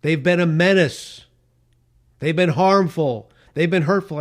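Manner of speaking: loud, slow, indignant caller voice; hammed-up outraged accuser; mocking hostile caller. Radio host mimicking an antisemitic caller, loud and slow.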